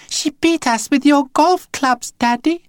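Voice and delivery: fake-hurt little boy; whiny child voice. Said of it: "who was a very good actor".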